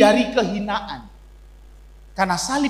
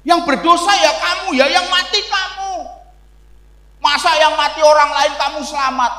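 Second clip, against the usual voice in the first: imitating a teacher's accusing lecture, pitched higher and louder; religion teacher pressing the pupil, raised accusing voice; lecturing teacher; 6 semitones higher, 4 dB louder than usual. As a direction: replay the teacher scolding the class, a touch hammed-up. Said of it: "Karena guru itu mengatakan".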